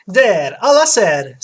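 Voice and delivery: loud Italian barber; Italian accent. Acted. Barber finishing the hair treatment, Italian accent. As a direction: play a hearty Italian barber. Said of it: "platinum blonde with Italian accent".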